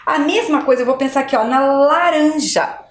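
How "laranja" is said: laranja slowly with a light tapped R; weak R. Shown as a demonstration. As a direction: clear model of laranja, weak R. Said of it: "O R tá fraco".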